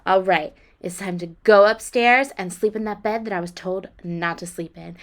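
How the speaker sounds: ordinary talk, nothing marked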